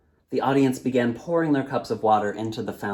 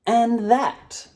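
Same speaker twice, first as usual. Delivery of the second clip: high, loud closing flourish; old storyteller; 12 semitones higher, 6 dB louder than usual. Acted. Storyteller ends his tale, high and grand. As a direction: wrap up with a flourish.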